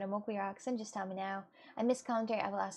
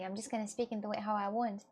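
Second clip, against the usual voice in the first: ordinary talk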